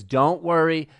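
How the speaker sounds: usual speaking voice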